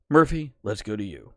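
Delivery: loud mock anchor hand-off; newscast voice. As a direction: hand over to a field reporter, loudly. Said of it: "my newscast voice".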